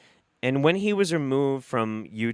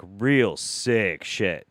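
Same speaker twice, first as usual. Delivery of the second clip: slow emphatic; 3 semitones lower, much slower than usual. Real, not acted. Slowed emphatic phrase.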